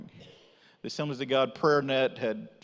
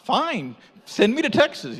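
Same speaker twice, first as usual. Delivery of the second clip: eager; 10 semitones higher, 8 dB louder than usual. Retold or imitated. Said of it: "And I'm like".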